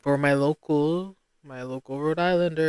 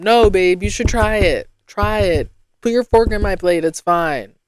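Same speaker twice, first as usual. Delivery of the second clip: insistent coaxing; 5 semitones higher, 9 dB louder than usual. Retold or imitated. Urging friend to share.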